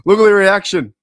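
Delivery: thrilled watching the reaction; excited. Real, not acted. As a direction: point it out eagerly.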